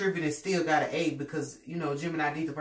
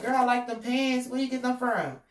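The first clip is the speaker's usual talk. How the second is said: fake sweet compliment; 8 semitones higher, 6 dB louder than usual. Competitor fake-complimenting Gemini, high and sugary.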